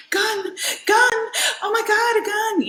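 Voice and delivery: high-pitched panicked squeal; acted panic, voice shooting way up; freaking out. Retelling learning her husband has guns, freaked-out squeal. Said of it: "freaking out".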